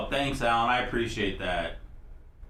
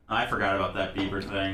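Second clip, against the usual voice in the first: normal talking voice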